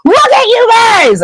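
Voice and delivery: high-pitched incredulous squeal; retold outraged shriek; imitated shriek. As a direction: shriek in disbelief at the boys. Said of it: "Hermione shrieks and she's like".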